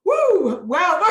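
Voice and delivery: high loud delighted whoop; excited whoop. Real, not acted. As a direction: cheer at the kind words. Speaker reacts to praise.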